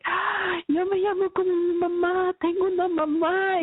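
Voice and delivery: high-pitched retold joy, sustained; elated "ah" carried into a proud claim; proud delight. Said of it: "así bien orgullosa de".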